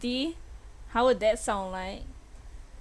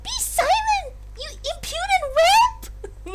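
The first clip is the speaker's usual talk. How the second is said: very high, haughty princess voice; snooty Princess Peach. Acted. Streamer voicing the princess scolding the defendant, snooty and high. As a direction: look down on the whelp, way up high. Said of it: "So Princess Peach".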